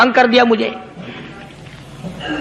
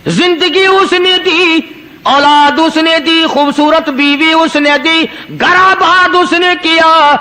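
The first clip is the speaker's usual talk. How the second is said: slow, raised, drawn-out praise; fervent preaching; 4 semitones higher, slower than usual. Acted. Preacher listing God's gifts to the crowd, slow and raised. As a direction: hammer each اس in turn, slowly.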